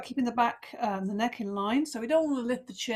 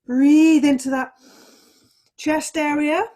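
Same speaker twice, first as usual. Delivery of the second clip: breathing in, stretched long and loud; drawn-out breath cue; 3 semitones higher, 12 dB louder, slower than usual. Shown as a demonstration. Yoga teacher cueing her class's inhale, long and loud.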